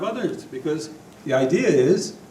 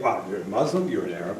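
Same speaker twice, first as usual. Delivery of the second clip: mock dismissive voice; 8 semitones lower, 5 dB quieter, faster than usual. Speaker mocks how leaders snub foreign diplomats, low and quieter. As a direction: drop low and sneer the labels mockingly. Mocking a rude diplomat's quote.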